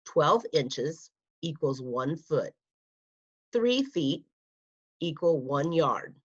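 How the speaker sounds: ordinary delivery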